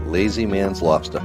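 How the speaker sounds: ordinary talk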